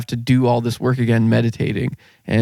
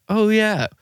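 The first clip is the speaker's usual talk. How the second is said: higher-pitched moment of recognition; re-enacted realization; 9 semitones higher than usual. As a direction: act out suddenly remembering. Voices the realization once more.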